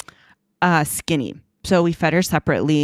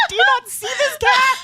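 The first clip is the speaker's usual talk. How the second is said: high, loud laughing cry; laughing outcry; 4 dB louder than usual. Retold or imitated. Retelling pleading with Ashley, high laughing outcry. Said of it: "I was like".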